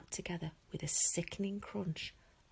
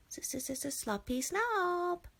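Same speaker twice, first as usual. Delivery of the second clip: very slow, high, hissing stutter on the s; stuttering dragon taunt; 12 semitones higher, much slower than usual. Acted. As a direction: drag out the s like a cheeky dragon. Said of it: "Toothless called out".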